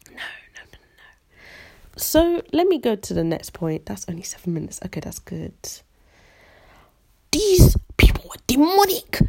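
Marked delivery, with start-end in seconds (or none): 0.1-2.0 very soft, trailing murmur; whispered no. Real, not acted. Vlogger dismissing her table laughing, whispered nos. Murmured repeated no after story. 7.3-9.2 loud, high-pitched exclamation; exasperated outburst. Real, not acted. Vlogger condemning her old school, exasperated outburst.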